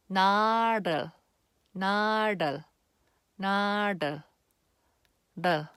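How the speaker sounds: syllable drill "나를"; Korean 나를 demo. Shown as a demonstration. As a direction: clear careful "나를" three times. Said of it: "But if it's with the, another letter".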